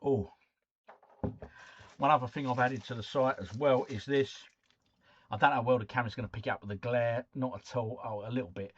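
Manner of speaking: ordinary talk, nothing marked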